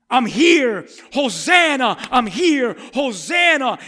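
loud high triumphant proclamation; preacher voicing Jesus, shouted and high; Jesus proclaiming. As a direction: announce his arrival with full voice. Jesus voiced proclaiming arrival.

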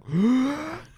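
high gasp for air; sharp gasp. Retold or imitated. Choking on the dab, high gasp. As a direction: gasp like the smoke hit hard. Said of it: "and I'm like".

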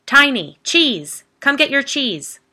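loud, high calling out; yelling. Acted. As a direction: call the dog loudly from inside. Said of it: "yelling from inside the house".

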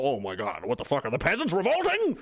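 high, rising panicked aristocrat; a lightly voiced panicking elite. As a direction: play a rich man in a fright. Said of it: "they're gonna get real serious and go".

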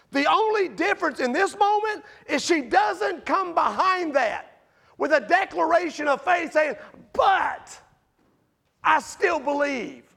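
rising preacher's shout; full-voiced sermon shout; preaching shout. Real, not acted. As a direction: build it up and cry it out.

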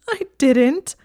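high, loud sobbing protest; tearful protest. Acted. Hermione denying she always cried before, tearful protest. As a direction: protest it through flowing tears. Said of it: "trying to ruthlessly banish the tears".

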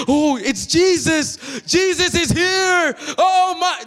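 high, slow, starstruck gasp of joy; giddy excitement. Shown as a demonstration. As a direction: sound thrilled that Jesus just walked in. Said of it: "That should be your attitude".

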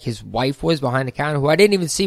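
ordinary talk, nothing marked

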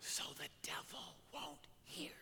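very soft, secretive whisper. Retold or imitated. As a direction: breathe it out in a hushed whisper. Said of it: "Why are we whispering?".

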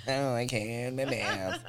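teasing parrot of her, laughing; mocking imitation. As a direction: mimic her line in a silly voice. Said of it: "echoing her line".

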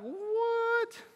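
high, squeaky what; baffled. Retold or imitated. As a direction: gasp it out in disbelief. Disciples baffled.

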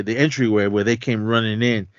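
normal talking voice

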